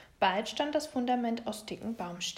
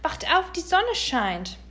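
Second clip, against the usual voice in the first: drawn-out, higher, louder wake-up call; 7 semitones higher, 8 dB louder than usual. A narrator's reading. Little bear waking his parents at the cave, calling out. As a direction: call out slowly to wake sleepers. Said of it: "zog sie am Fell und rief".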